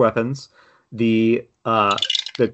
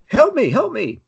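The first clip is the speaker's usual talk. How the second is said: very high cry for help; shouted plea; 13 semitones higher than usual. Acted. Captive in the crab's claw shouts to his rescuers, high shouted plea. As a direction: call out in a high-pitched panicked plea. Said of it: "and then he shouts".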